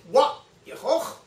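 careful Klingon Wa Yehoch; Klingon pronunciation. Shown as a demonstration. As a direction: demo Wa Yehoch slowly, ch scraped in the throat. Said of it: "the way you say that in Klingon".

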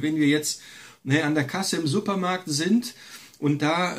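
normal talking voice

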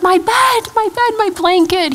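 put-on high-pitched, delighted child voice; glad little boy. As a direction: imitate a small boy thrilled to be home. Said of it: "He was just so glad to be home".

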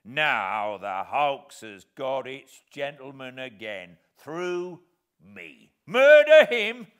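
loud, slow, scornful rant; disdainful. Acted. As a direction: sneer at the idea with contempt. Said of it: "said my convict disdainfully".

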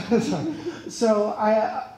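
laughing. Real, not acted. Laughing at coming-out joke.

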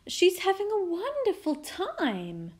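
high, airy chat; pleased queen. A narrator's reading. Queen chatting to the king, pleased. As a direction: report it contentedly in a high voice.

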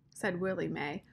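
usual speaking voice

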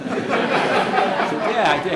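laughing through a loud yes; can't stop laughing as he agrees. Real, not acted. Laughing at the tag question.

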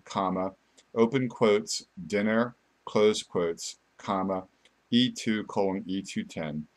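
slow, spelling out each part; dictating. Shown as a demonstration. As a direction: read it out piece by piece. Reads the formula out piece by piece.

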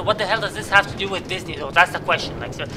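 ordinary delivery

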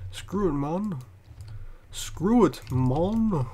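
cocky mock tough-guy growl; hammed-up brash tough guy; fake tough guy swagger.

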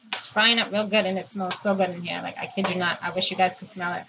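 usual speaking voice

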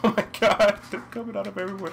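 high, fast alarm; panicked outburst. Real, not acted. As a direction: cry out as enemies swarm in. Overrun by enemies.

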